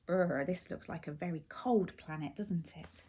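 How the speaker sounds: chatting softly with the little listener. Real, not acted. Parent pausing the story to ask the child.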